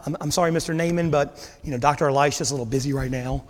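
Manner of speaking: lightly voiced nervous servant; timid servant. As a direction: sheepishly pass on the brush-off. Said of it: "Can you imagine being that servant?".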